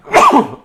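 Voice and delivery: big sneeze cutting off a word; loud sneeze. Real, not acted. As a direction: sneeze right through the word. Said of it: "that was a big sneeze".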